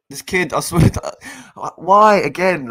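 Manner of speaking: loud high frustrated rant; exasperated outburst. Real, not acted. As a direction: let the anger burst out. Fan rants at defender's mistake.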